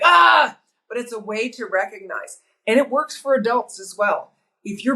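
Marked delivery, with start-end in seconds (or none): 0.0-0.6 high, loud mock "ah!"; imitated frustrated yell. Mother imitating her frustrated daughter, high mock yell. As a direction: let out an exasperated mock cry.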